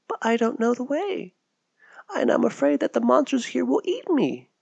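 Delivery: put-on small girl afraid of monsters; timid child pleading; frightened little girl. Mary voiced as a child.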